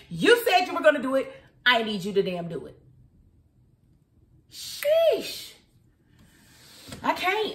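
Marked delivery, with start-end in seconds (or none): 4.5-7.5 high-pitched drawn-out sheesh; exasperated outburst. Real, not acted. Venting to viewers after a student loan rant, exasperated. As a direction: let out a squeal of exasperation.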